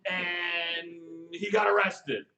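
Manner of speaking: mock know-it-all, loud and high. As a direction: ham up a clueless know-it-all. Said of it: "They're like".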